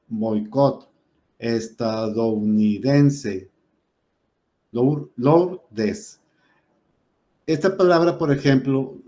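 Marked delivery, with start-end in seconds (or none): 0.1-6.2 "Estadounidense" stretched out syllable by syllable; teacher's slow example; slow syllable demo. Shown as a demonstration. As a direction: break "Estadounidense" slowly into syllables. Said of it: "dividing words into syllables".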